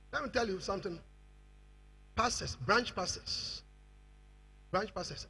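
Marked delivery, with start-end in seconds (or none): none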